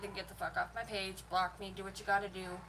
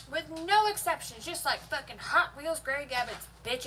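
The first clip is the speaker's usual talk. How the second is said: heated outburst; 4 semitones higher than usual. Real, not acted. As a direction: blow up at them, voice climbing. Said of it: "I'm getting heated now".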